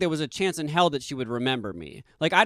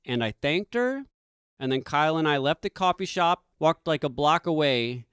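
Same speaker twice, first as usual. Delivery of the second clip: ordinary talk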